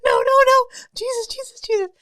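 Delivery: high, frantic mock cries, loud at first; boy's panicked pleading. Blamed boy facing the Grither, panicked pleading. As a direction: babble like a terrified boy. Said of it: "And he goes".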